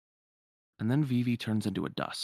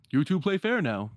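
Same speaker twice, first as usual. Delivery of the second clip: higher, projected presenter voice; raised-pitch emcee delivery; tournament producer; 5 semitones higher, 4 dB louder than usual. Acted. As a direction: address both contestants like an event host. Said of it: "And then the producer".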